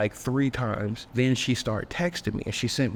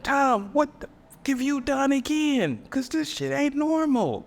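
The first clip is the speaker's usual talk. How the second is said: much higher, drawn-out disbelief; high shocked voice. Retold or imitated. Man asking his date, shocked and way higher. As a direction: squeak it out in alarm. Shocked at date's strange behavior.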